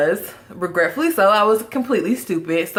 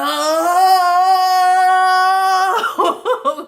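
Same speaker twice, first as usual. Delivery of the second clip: a big high burst of laughter; howling laugh, real and loud; 9 semitones higher, 5 dB louder than usual. Real, not acted. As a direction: crack up at your own story. Bursts out laughing at her story.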